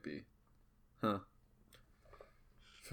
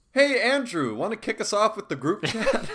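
higher-pitched, put-on host cue; mock podcast clip; 7 semitones higher than usual. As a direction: toss it over like a chirpy podcast intro. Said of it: "this voice clip".

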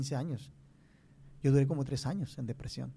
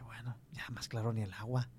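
hushed self-talk; 3 semitones lower, 7 dB quieter than usual. Retold or imitated. Retells his own private conclusion.

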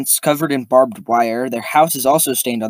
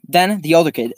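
ordinary delivery, nothing marked

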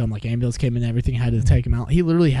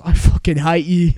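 put-on louder, higher stab at a Swedish accent; attempted Swedish accent; 4 semitones higher, 6 dB louder than usual. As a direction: put on a rough, half-managed Swedish accent. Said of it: "in the Swedish accent".

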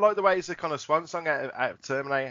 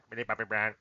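low, soft mumbled name; teasing impression of a besotted friend; mock friend's answer; 6 semitones lower, 6 dB quieter than usual. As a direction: drop low and quiet for the punchline.

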